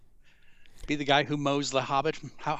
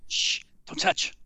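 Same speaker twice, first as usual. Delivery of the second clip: whispered shush. Pretend. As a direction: whisper it like a strict guard. Playing museum guard shushing.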